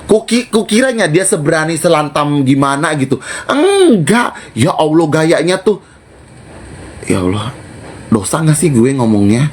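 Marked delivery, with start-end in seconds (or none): 3.5-4.4 mock denial. Mocking the rival's style.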